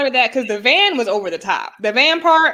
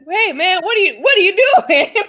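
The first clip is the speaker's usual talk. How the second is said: mock shout; 7 semitones higher than usual. Eric's retold quote, shout heard.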